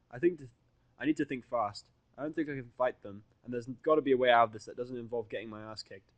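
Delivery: ordinary talk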